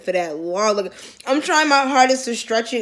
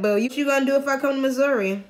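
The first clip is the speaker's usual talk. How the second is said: normal talking voice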